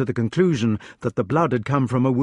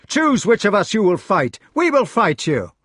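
defiant shout; 8 semitones higher, 4 dB louder than usual. A narrator's reading. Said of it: "the knights shouted".

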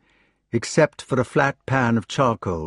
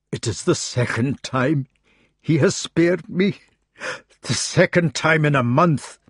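Scottish burr, strained with shame; a wounded Scots knight close to tears; grieving Scots Gawain; 5 semitones higher than usual. Acted. Gawain lamenting to Arthur in a Scottish burr.

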